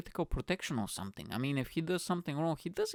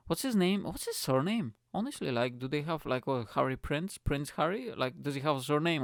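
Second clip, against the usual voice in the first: ordinary talk, nothing marked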